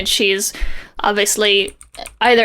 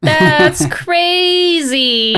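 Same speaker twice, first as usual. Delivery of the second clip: drawn-out, higher fake astonishment; mock shock; 4 semitones higher, much slower than usual. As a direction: ham up the gasp of surprise, slowly. Mock shock at comic twist.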